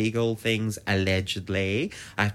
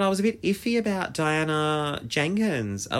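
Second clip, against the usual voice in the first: ordinary talk, nothing marked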